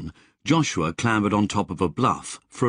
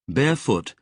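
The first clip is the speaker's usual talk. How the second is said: plain reading voice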